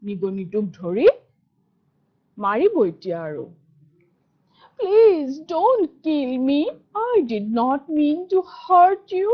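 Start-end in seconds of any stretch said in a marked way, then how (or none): 4.8-9.3 pleading mouse. Acted. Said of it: "the mouse pleads again".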